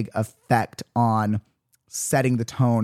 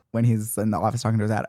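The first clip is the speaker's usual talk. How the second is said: ordinary delivery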